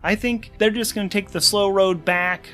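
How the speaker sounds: ordinary talk, nothing marked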